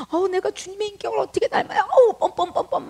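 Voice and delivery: high, coy protest with a laugh; bashful bride. Acted. The bride protests the Lord's praise, high and giggling. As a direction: squeal it out, embarrassed and flattered.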